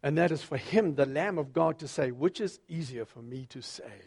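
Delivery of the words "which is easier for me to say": lower, softer, weighty question; a hushed commanding Jesus. Shown as a demonstration. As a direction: let it drop low and quiet with authority. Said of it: "I like to think he had that voice".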